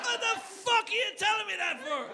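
very high, drawn-out outraged voice; outraged squeal. Acted. Acting out outrage at being told, high squeal. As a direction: squeal the protest slowly.

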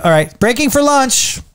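set assistant call. Retold or imitated. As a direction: announce the break to the crew. Mimics film set announcements.

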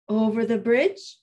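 plain reading voice

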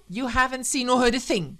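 loud, high intimidating order; threatening. Acted. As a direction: warn the boy fiercely to forget it. Woman threatens boy witness.

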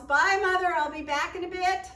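high, loud laughing call. Acted. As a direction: shout goodbye with a laugh. Boy calls goodbye running out.

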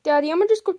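usual speaking voice

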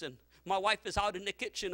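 normal talking voice

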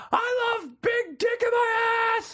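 slow high stadium scream; drawn-out high yell. Retold or imitated. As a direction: shout it over the crowd noise. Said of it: "I kept going".

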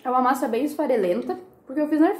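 talking while chewing food; chewing burrito between words; mouth full chewing. Real, not acted. Seen 3: Bit the burrito at -1s; mouth still chewing while speaking.

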